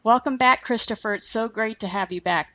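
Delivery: ordinary talk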